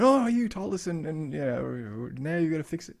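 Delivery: high, loud, put-on grumbling; mock complaint. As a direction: imitate an indignant customer making demands. Mimics complaining parents they weren't.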